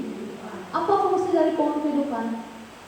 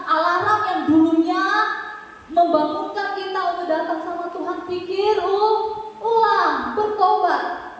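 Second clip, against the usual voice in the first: rousing preaching; 6 semitones higher than usual. Real, not acted. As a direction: build to a higher, insistent call. Preaching builds to a call. (khotbah menggugah, makin tinggi)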